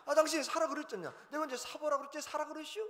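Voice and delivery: high, loud indignant protest; hammed-up spousal squabble; acted bickering. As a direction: argue back like a married couple. Acted husband and wife quarrel.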